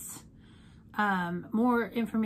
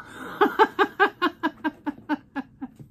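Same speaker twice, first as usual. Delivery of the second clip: high bright burst of laughter; real laugh; 6 semitones higher, 7 dB louder than usual. Real, not acted. Vlogger laughing at her makeup-stained shirt, real laugh. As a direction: laugh at your own joke.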